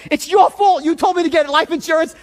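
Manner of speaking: high, loud blaming outburst; really pissed. Retold or imitated. Pacing man blaming his aunt, really pissed. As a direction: lash out, acting out the rant. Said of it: "Now I get really pissed".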